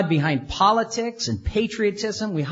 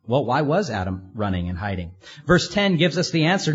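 ordinary talk

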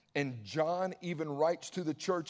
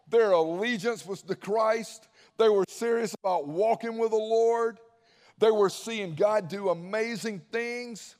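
higher and louder pulpit drive; preaching at full force; 4 semitones higher, 6 dB louder than usual. Acted. Pastor rousing the congregation, preaching louder and higher. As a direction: lift the room, preach it out.

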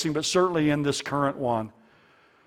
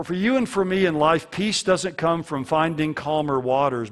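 ordinary talk

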